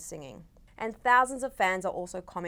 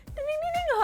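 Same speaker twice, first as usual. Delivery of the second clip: mock chime. Imitating a gentle alarm sound.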